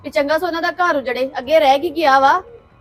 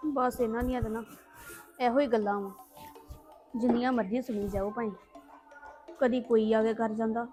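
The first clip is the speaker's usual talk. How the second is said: soft, sulky muttering to herself; quiet grumbling; 2 semitones lower, 9 dB quieter than usual. Acted. Daughter muttering to herself after the family fight, quiet and sulky. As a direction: grumble under your breath, alone and resentful.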